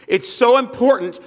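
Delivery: "so" lifted high and loud in "It's so important"; stressed so. Acted. Preacher to congregation, pushing "so" up high and loud. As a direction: lean hard on "so", pitch up.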